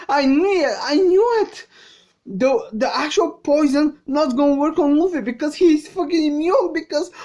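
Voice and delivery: high, loud, vindicated exclamations; real excited outburst. Real, not acted. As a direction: burst out excitedly, pitched high. Excited reaction to plot twist.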